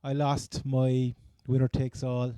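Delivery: ordinary talk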